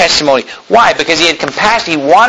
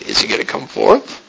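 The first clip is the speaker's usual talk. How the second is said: hushed, whispery question; soft, suspenseful wondering; wondering thought; 6 dB quieter than usual. Acted. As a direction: whisper it like a waiting onlooker. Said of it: "They're thinking".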